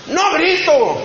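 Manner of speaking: negación alterada, aguda y casi a gritos; grito negándolo. Retold or imitated. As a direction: niega que gritas, alzando la voz en broma. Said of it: "gritas mucho". (gritando a la defensiva, imitado)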